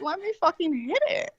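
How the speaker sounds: high, pushy plea; insistent demand. Retold or imitated. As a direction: insist on getting a turn. Insisting on trying the vape.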